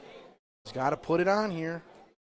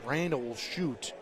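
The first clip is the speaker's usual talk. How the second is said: ordinary talk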